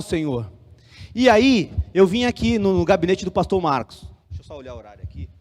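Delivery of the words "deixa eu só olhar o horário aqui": low, very soft mumbled aside; quick aside. Real, not acted. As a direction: drop right down, as if talking to yourself. Aparte para conferir o horário. (aparte bem baixinho)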